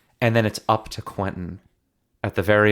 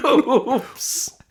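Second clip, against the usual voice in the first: laughing. Pretend. Mocking the character's fake accident.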